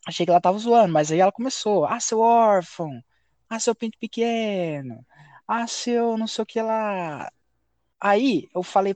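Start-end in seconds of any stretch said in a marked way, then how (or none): 1.8-7.3 slow, drawn-out imitated name-calling; mocking taunts. Teammate starting to insult him in game, imitated slow drawn-out taunting. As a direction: stretch each insult into a sing-out jeer.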